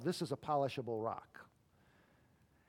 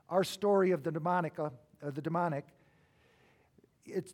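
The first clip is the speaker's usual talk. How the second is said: ordinary talk, nothing marked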